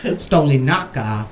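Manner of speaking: slow full name. Shown as a demonstration. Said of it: "It's Stoli".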